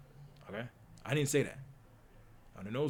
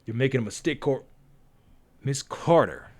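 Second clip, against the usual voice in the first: sharp correction; 9 dB louder than usual. Acted. Cordelia correcting the stranger, sharp and louder. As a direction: snap back at him.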